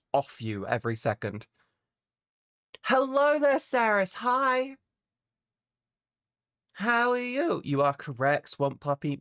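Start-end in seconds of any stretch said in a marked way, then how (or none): 2.8-7.6 high, lifted hello to a chatter; greeting Saris in a raised sunny voice; bright greeting. Real, not acted. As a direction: welcome a viewer warmly.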